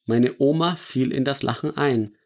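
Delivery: ordinary delivery, nothing marked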